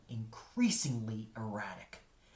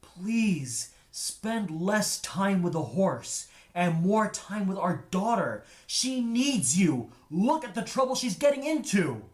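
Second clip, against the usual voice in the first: higher, louder and slow, begging harder; desperate pleading; 8 semitones higher, 8 dB louder than usual. A narrator's reading. Father begs his wife to help their daughter, desperate plea. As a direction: plead as if she finally has to listen. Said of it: "The composer would plead with his wife".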